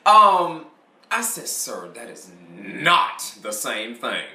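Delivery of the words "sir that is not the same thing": slow, deliberate refusal; slow firm retort. Retold or imitated. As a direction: spell it out slowly to the seller. Customer refuses salesman, retold.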